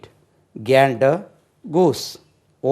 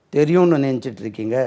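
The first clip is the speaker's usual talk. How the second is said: normal talking voice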